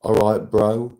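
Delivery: punchy laddish hello; matey greeting. Shown as a demonstration. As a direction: call it out like one of the lads. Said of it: "it would have two different meanings".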